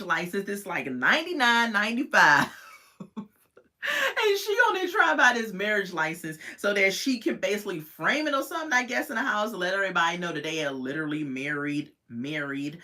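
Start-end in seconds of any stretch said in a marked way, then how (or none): none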